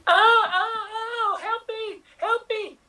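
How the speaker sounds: high, crying cry for help; high panicked crying plea; Linda Hamilton in distress. Acted. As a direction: wail it out like a terrified heroine. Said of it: "you are going to be Linda Hamilton".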